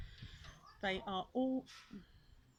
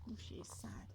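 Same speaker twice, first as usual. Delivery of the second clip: whispered aside; 8 dB quieter than usual. Real, not acted. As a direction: murmur it gently under the story. Aside to child about sadness.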